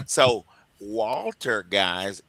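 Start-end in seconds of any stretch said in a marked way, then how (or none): none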